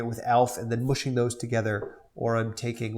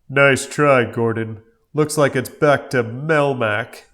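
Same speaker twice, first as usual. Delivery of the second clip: smug cop; 7 dB louder, slower than usual. Acted. Cop voiced in read script.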